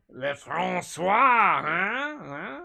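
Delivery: mock French, drawn out slowly and loud; a hammed-up French accent ending in a little whine; ironic French accent. Shown as a demonstration. Streamer showing chat a slow hammed-up mock French accent. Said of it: "ironic French accent".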